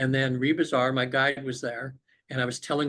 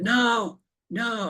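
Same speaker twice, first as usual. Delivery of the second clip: high-pitched, insistent no; emphatic refusal; 9 semitones higher than usual. Retold or imitated. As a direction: act out refusing firmly, voice jumping up. Acting out refusing facilitator in trance.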